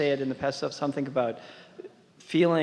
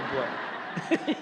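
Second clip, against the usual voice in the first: short embarrassed laugh. Real, not acted. Embarrassed admission of trying too hard.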